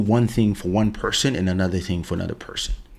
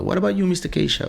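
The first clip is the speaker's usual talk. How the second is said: higher, fast waiter voice; imitating the waiter; 9 semitones higher, much faster than usual. Waiter turning to the dad, high quick question. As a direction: ask quickly like a busy waiter. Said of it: "I was like".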